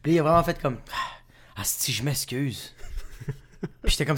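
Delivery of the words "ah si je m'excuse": low, slow, hushed apology; sheepish apology. Retold or imitated. Producer apologizing to the actor on the phone, sheepish and hushed. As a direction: shrink back softly as you apologize.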